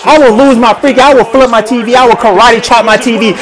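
ordinary delivery

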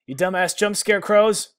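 loud, slow furious yell; real outburst of rage; angry yell. Real, not acted. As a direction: bellow at the scarecrows. Yells at the scarecrows.

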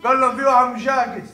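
usual speaking voice

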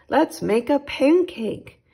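put-on eager boy. Jack eager for pancake.

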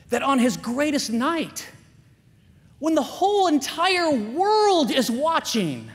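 fiery sermon climax; full-force preaching. Acted. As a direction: preach it hard, rising to a roar. Preaching at full force about Will Smith.

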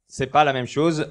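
ordinary talk, nothing marked

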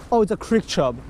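ordinary talk

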